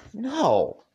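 high, loud furious shout of no; angry yell. Acted. Dave yells back at Rose in rage, loud and high. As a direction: yell it in his face. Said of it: "I yelled at him now".

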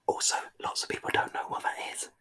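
breathy whisper. Pretend. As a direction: whisper back quietly. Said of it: "everybody has to whisper back".

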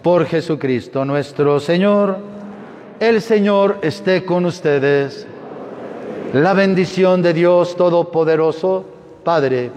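ordinary talk

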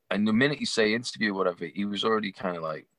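ordinary delivery

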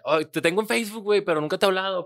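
high fast fan voice; imitating a fan at the bar; eager fan. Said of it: "es de que te bajas al baño y de que".

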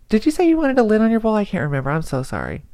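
high, rushed, sheepish question; mock flustered worker asking a customer again; flustered apology. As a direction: hurry through it, embarrassed. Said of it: "I'll say".

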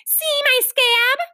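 put-on high, drawn-out childish boast; proud showing off. A narrator's reading. Four-year-old raises her knee to the ladies, proudly. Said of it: "she said proudly".

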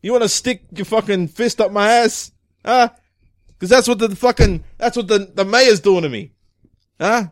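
angry police chief. Acted. Chief berating detective caught in traffic, angry. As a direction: chew out your officers as the chief.

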